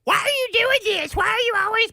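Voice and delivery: exaggerated screechy high nagging mom; mom impression. Son mimics his mom complaining, high hammed-up voice. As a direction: nag at the very top of your voice range. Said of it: "she sounds like".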